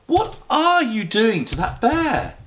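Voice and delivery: scandalised Mrs Brown. Acted.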